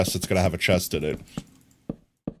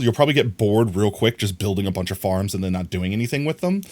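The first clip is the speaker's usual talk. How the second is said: ordinary delivery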